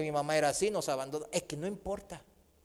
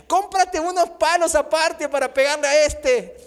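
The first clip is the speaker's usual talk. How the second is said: a hint of the scolding mother, higher and louder; mother's sarcasm; 6 semitones higher, 6 dB louder than usual. Preacher quoting his mom's sarcastic jab, higher. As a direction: tease like an exasperated mom. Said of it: "le decía".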